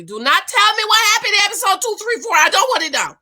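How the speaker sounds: pleading viewers not to spoil episodes; no spoilers plea. Real, not acted. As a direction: cut them off before they spoil it.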